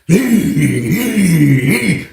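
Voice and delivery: high, drawn-out "ee" for each kick; vocal mimicry of a kicked cell door; imitated door kicking. Inmate showing how a kicked door sounds, imitated squeal. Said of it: "That's how I sound when somebody kicking their door".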